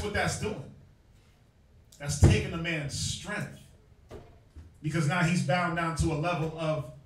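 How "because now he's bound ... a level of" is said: normal talking voice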